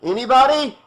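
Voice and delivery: high, loud call into the dark house; calling out for someone, getting no answer. Acted. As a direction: shout a name into an empty room.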